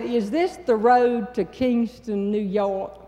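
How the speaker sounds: slow, drawn-out Southern question; slow Southern accent. Retold or imitated. Asking the toll booth man the way, in a slow Southern drawl. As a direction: stretch each word out like a Southern belle. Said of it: "pull out my Southern accent".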